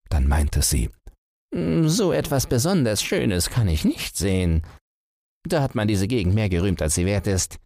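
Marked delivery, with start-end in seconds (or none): 1.5-7.6 high, dismissive tortoise voice; tortoise unimpressed by the view. Acted. Tortoise feigns disappointment.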